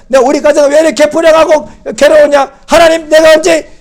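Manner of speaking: high, loud mock tirade; retold cursing at God. Preacher reenacting his three-hour rant at his brother, loud and high. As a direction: rail at God like the angry young man. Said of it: "얼마나 욕했는데요".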